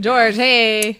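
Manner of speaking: hammy, loud hello; playful greeting call. Pretend. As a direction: call out George's name like an old pal. Calls out to the ghost in greeting.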